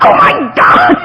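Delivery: high, loud laughing cry; panicked call to her sponsor; frantic outburst. Retold or imitated. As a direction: blurt it out in alarm. First anger, calling sponsor.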